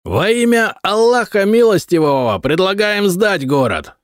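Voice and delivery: high proclaiming yell; high herald's cry of surrender terms. A narrator's reading. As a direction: bellow the terms at the walls. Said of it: "заорал всадник".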